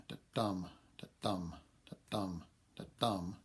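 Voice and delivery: four separate beats of "da-dum", each stressed on "dum"; iambic rhythm. Shown as a demonstration. Lecturer demonstrates four iambs in a line, "da-dum" with stress on "dum". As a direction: beat out "da-dum" evenly, unstressed "da", stressed "dum". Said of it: "So if you get four of those in a line".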